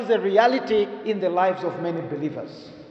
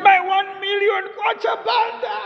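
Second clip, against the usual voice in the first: mock lament. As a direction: cry out over lost money. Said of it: "He said".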